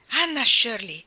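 exclaiming. A narrator's reading. Guardian confronts the child over the cake, exclaiming. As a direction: call her out by full name, sharply. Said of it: "esclamò".

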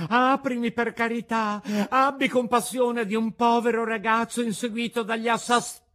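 high, loud calling out to the window; desperate shout. A narrator's reading. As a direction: call to the girl in despair. Pinocchio begs, cut off. (Pinocchio grida alla bambina dalla finestra)

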